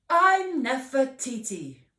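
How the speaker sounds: proud, loud, lifted name; a girl proudly introducing herself. Acted. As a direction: say your name with pride. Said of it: "Nefertiti said proudly".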